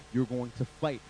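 normal talking voice

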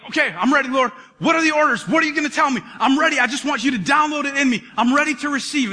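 high, eager and loud; eager reply. Pretend. Ezekiel answering God, high and eager. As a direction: answer God like a keen recruit.

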